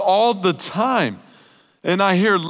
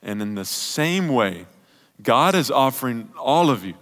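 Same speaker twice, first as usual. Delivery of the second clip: much lower and softer, tender; intimate preaching; 10 semitones lower, 6 dB quieter than usual. Real, not acted. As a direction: draw them in quietly, tender sermon close. Quiet closing sermon continues.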